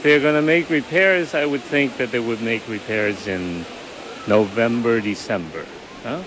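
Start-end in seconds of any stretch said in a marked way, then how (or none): none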